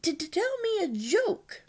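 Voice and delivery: high, stuttering little dragon voice; Toothless. A narrator's reading. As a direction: stammer out a demand in a squeaky voice. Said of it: "Toothless has a little bit of a stutter".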